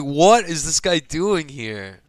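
slow, puzzled disbelief; mock-bewildered slow question; baffled. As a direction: wonder aloud what on earth he's doing there. Retold baffled inner thought.

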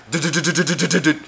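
rapid-fire stuttered patter; imitated running footsteps. Storyteller mimicking feet hurrying downstairs, rapid patter. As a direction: drum out quick footsteps with the voice. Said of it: "All of a sudden I hear".

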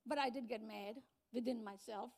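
ordinary talk

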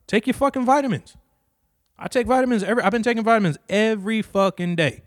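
loud, high, worked-up ranting; heated rant. Real, not acted. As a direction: get fired up and rant it. Heated rant about vitamins.

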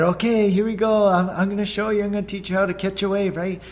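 high, eager wave-catching pep talk; excited dad coaching. Retold or imitated. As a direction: hype her up for the wave. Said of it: "I said".

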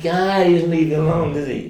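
high, loud exasperated complaint; retold fed-up outburst; mock exasperation. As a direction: wail about a relentless pest. Reacts to relentless email.

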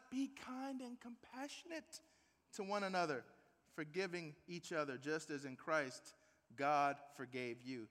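quiet, slowed reading of the verse; soft scripture reading. Acted. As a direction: let the words of forgiveness settle softly. Reads scripture gently.

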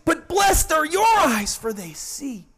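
high-pitched burst of excitement, dropping at the end; excited Jesus. Acted. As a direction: exclaim it with joyful excitement, imitating Christ. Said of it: "hear the excitement in His voice".

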